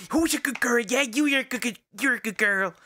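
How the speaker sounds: high, sing-song praise for a puppy; baby talk to a dog. Retold or imitated. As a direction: coo like praising a good dog. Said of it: "like the baby talk with dogs".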